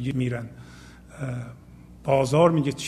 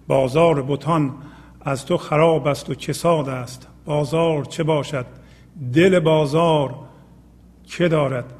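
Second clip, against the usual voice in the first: poem recitation; 4 dB louder than usual. Acted. Reciting the next poem line.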